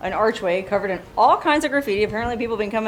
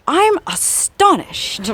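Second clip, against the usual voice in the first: high, slow, exaggerated amazement; mock astonishment; 9 semitones higher, slower than usual. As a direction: ham up the surprise sarcastically. Ironic reaction to [name] graffiti.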